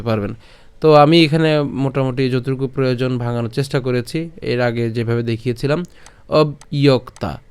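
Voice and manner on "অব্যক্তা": অব্যক্তা said slowly as obiok-ta, joined in one breath; slow demo of অব্যক্তা; pronunciation demo. Shown as a demonstration. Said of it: "এই যে বলেছিলাম".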